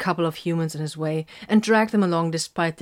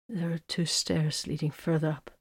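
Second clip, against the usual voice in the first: soft, weakened voice; faint, subdued murmur; hardly able to speak; 7 dB quieter than usual. A narrator's reading. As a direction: give directions with little strength. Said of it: "sounded like he could hardly speak".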